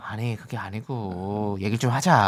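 calm a partner down slowly; gentle opener. Shown as a demonstration. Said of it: "부드럽게 시작하세요". (낮고 차분하게 달래듯)

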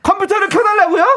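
high, loud, incredulous echo; comically loud repeat-back question; really loud voice. Acted. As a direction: blurt the request out for all to hear. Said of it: "진짜 큰 소리로".

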